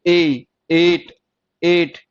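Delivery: ए stretched, also inside एट; very slow, separate ए and एट; long vowel. Shown as a demonstration. Teacher showing ए inside a word, long vowel. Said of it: "ए की sound आ रही है".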